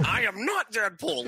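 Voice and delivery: high-pitched imitation of an offended character; mock indignant protest. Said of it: "And they're like".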